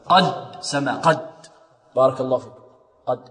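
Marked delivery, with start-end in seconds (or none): none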